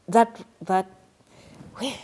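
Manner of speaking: real emotion overtaking the words; getting emotional. Real, not acted. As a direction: voice catching, dropping to a breathy near-whisper. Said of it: "I'm getting emotional".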